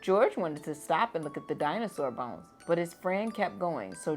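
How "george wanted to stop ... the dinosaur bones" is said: plain reading voice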